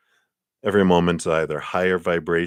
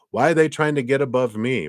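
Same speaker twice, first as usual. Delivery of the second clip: resentful whine; 7 semitones higher, 4 dB louder than usual. Retold or imitated. Resentful person voiced.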